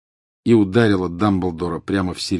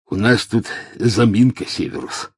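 gruff Death Eater; 4 semitones higher, 3 dB louder than usual. A narrator's reading. Amycus reports to Snape.

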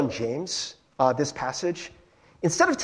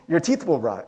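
usual speaking voice

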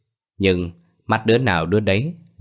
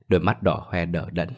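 ordinary delivery, nothing marked

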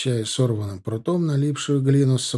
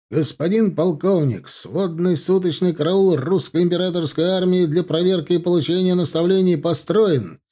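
high, crisp parade report; formal military report; 5 semitones higher, 4 dB louder than usual. A narrator's reading. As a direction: report smartly to the colonel.

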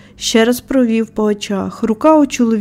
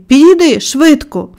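higher, louder urgent command; shouted order; 5 semitones higher, 5 dB louder than usual. A narrator's reading. Boy summoning his friend urgently, shouted order. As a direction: hurry him over with raised voice. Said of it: "крикнув він до Дікена".

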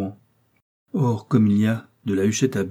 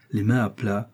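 usual speaking voice

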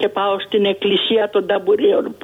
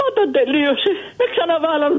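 voice breaking; 6 semitones higher than usual. Real, not acted. Old caller moved recalling childhood.

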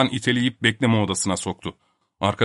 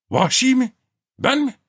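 very high, affronted questions; indignant disbelief. A narrator's reading. Topak answering a charge of savagery, indignant disbelief. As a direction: throw the accusation back, incredulous.

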